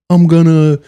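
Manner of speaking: high, loud put-on woman's voice; imitated earnest woman. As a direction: mimic her declaring herself, pitched high. Imitates woman approaching motivational guy.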